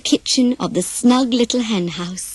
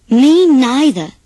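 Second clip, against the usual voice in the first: firm refusal; 3 semitones higher, 7 dB louder, slower than usual. Acted. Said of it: "said David Duck".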